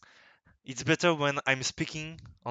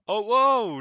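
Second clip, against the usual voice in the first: loud, high surprised exclamation; be struck by what you see; wow; 10 semitones higher, 11 dB louder than usual. Real, not acted. Surprised at the page.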